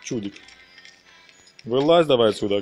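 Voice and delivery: normal talking voice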